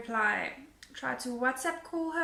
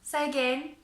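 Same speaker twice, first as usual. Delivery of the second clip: ordinary talk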